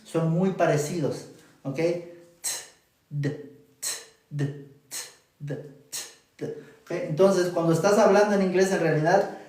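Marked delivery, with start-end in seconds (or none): 3.1-6.4 t and d said apart, crisp and exaggerated. Shown as a demonstration. Teacher exaggerating T and D to compare. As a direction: contrast t and d, overdone for students. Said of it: "yo los estoy exagerando".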